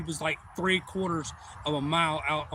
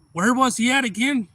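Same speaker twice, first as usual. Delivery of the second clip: louder, higher demand to know; acted urgent question; 7 semitones higher, 8 dB louder than usual. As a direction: call it out, imitating an urgent man. Owner urgently locating the alligator.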